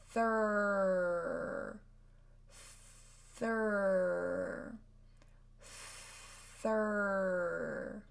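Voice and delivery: Thur held long and slow, TH into R; slow Thur drill. Shown as a demonstration. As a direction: slowly stretch Thur. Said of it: "Try it slowly and then go faster".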